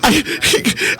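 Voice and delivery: ordinary talk, nothing marked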